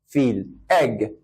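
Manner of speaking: ordinary talk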